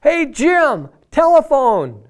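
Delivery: high, loud call across a noisy bar; bartender yelling. Retold or imitated. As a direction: holler a name across the room. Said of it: "and he yelled".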